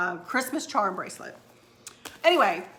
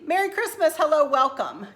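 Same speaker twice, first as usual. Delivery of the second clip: high, bright welcoming call; cheery greeting; 5 semitones higher, 4 dB louder than usual. Pretend. Hostess greeting guests at the garage door, cheery call.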